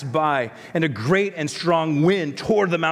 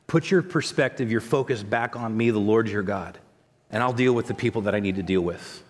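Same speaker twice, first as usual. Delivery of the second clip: gentle reassurance; 6 semitones lower than usual. Acted. God speaking gently to Elijah.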